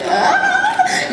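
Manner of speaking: high squeal of alarm, laughing; mock shriek. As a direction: let out a laughing little shriek. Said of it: "I was like".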